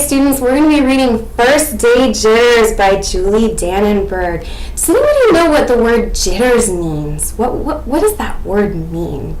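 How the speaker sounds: ordinary delivery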